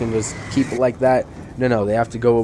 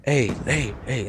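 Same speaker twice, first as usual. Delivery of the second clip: hushed call to a friend; imitated whisper; 6 dB quieter, slower than usual. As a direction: softly get a friend's attention, imitating a whisperer. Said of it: "leans over and whispers to his friend".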